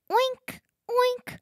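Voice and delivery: high, squeaky little oink; piglet oink. Acted. Reading the farm story aloud, piglet oinks high and squeaky.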